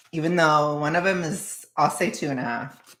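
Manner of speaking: ordinary delivery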